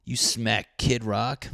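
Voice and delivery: ordinary talk, nothing marked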